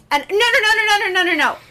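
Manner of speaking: high, loud string of panicked no's; frantic denial. Retold or imitated. Telling the coworker no in a loud high panic.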